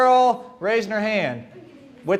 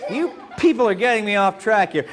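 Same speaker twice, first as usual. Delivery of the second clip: ordinary delivery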